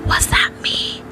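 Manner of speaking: hushed, breathy wonder; odd voice. Acted. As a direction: barely dare to ask it. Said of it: "said Christopher Robin in an odd voice".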